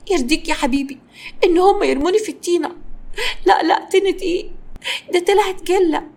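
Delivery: high-pitched, wheedling complaint; milk his sympathy, voice pitched high; put-on aggrieved pleading; playing the victim. A narrator's reading. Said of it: "وحاولت تلعب على الحتة دي".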